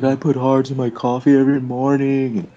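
lightly voiced high earnest sharer; mock sappy confession. Mocking a meeting member sharing a story, in a high earnest voice. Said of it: "it's like".

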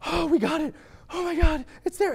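high, hushed mock awe; amazed relief. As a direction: marvel at the catch in high tones. Roommates marvel at captured mouse.

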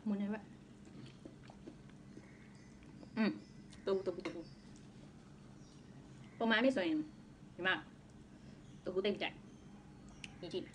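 talking while chewing food; muffled between bites; mouth full, chewing. Real, not acted. Seen 2: Eating with chopsticks throughout; closed chewing mouth, food bite at 11.3.

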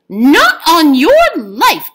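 loud, slow firm refusal; stubborn Jamie. A narrator's reading. Jamie gripping the leprechaun and refusing him, loud and slow. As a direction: hold on and refuse him flatly. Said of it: "Jamie said".

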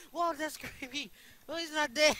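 loud, high-pitched disbelief; high shout of real surprise; startled yelling. Real, not acted. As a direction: yell it out, creeped out and amazed. Startled that enemy is alive.

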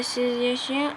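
normal talking voice

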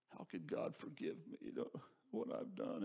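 whispered, halting question; old man's hushed doubt. Retold or imitated. Ninety-five-year-old man asking his pastor about forgiveness, hushed. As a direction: barely voice it, as a burdened confession.

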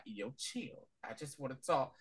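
quiet calming. Retold or imitated.

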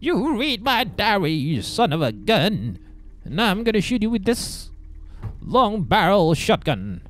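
put-on high, slow old lady threat; angry grandma voice. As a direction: drag out a granny's shotgun threat. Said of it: "This is where Grandma's gonna shotgun on us".

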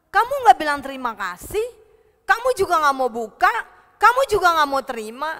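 loud, fast rebuke; accusing scolding. Acted. Grandma lists what Boy refused, scolding loudly. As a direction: fire off each accusation forcefully.

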